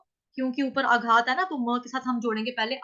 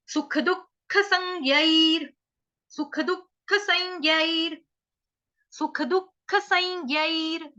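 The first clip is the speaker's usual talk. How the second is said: slow, repeated recitation; 3 semitones higher, slower than usual. Shown as a demonstration. Teacher repeats the verse phrase slowly for students. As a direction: chant it slowly three times. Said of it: "आधा स".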